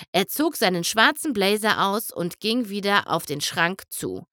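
plain reading voice

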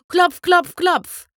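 loud knocking, clipped syllables; knocking sound. A narrator's reading. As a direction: rap out the knocks loudly. Knocking at the door imitated.

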